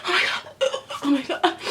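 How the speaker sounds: high, fast, overdramatic fretting; mock panicked drama queen. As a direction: ham up her rose panic. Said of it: "she go".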